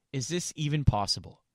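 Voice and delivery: ordinary talk, nothing marked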